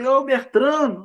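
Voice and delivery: high, drawn-out call; little voice calling out. Acted. A little voice calls to the believer, calling out. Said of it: "aquela vozinha gritando assim".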